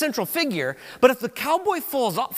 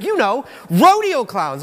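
imitated excited blurting; 2 semitones higher, 8 dB louder, slower than usual. Preacher mimics brother with ideas in his head, drawn-out shout. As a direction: mimic a brother hollering his half-formed idea. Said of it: "that's how he talks".

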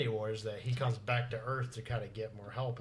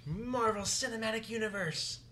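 movie trailer voice; 9 semitones higher than usual. Pretend. Mock announcing a title card.

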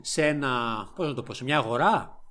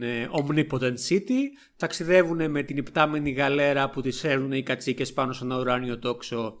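ordinary delivery

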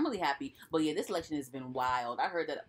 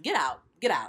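loud get out; 5 dB louder than usual. Pretend. Telling Trump to leave.

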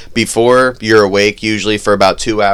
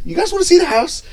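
high mimicking voice; faster than usual. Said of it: "He was like".

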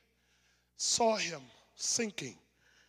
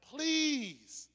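ordinary talk

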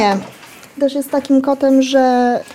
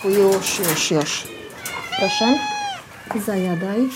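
softer, lower cooing to a cat; soothing a pet; 3 semitones lower, 6 dB quieter than usual. Real, not acted. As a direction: coax the cat gently to eat. Calming a cat at feeding. (czułe, ciche uspokajanie kota)

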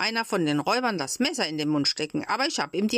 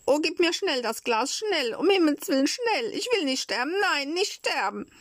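high, panicky begging; desperate pleading; 10 semitones higher than usual. Acted. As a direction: beg for life in a high child's wail. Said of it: "flehte Pinocchio". (hohes, angsterfülltes Flehen)

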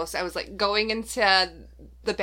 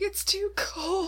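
high, drawn-out whine; hammed-up shivery grumble; mock complaining; 12 semitones higher, much slower than usual. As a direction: whine it slowly, pitched way up. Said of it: "quit my bitching".

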